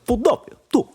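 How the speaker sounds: put-on high mumbled Philly accent; like a mouthful of marbles. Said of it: "like this".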